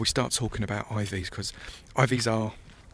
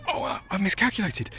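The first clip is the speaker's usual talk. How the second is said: surprised; 8 semitones higher than usual. Real, not acted. Sudden realisation of level.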